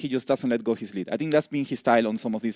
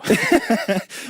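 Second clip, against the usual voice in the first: burst of laughter; 10 semitones higher, 5 dB louder than usual. Real, not acted. Co-caster laughs at rushed arrival.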